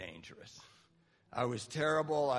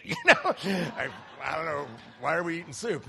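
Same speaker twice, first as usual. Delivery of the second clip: laughing question; 7 dB louder than usual. Real, not acted. Laughing at the soup memory.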